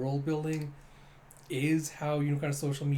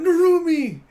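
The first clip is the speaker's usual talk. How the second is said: much higher, much louder cry of the name; calling out; 14 dB louder than usual. Retold or imitated. Little kid cries out to his rescuing captain, loud shout.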